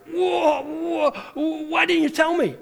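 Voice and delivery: high, loud startled whoa's, acted for laughs; mock startled cry. Pastor imitating a gym buddy who just found out, loud and startled. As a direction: throw up the hands, whoa, whoa, why the secret. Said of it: "They're like".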